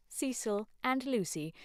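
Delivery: ordinary delivery, nothing marked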